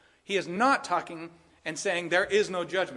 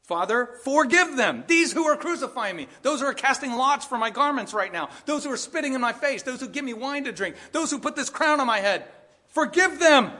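high, loud, pleading prayer building to a cry; Jesus praying on the cross; 8 semitones higher, 6 dB louder than usual. Acted. Jesus on the cross begging the Father, loud rising plea. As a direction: plead for them, rising to a call. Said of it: "He does not say that".

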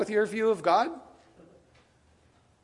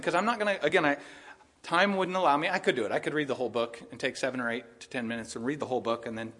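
ordinary talk

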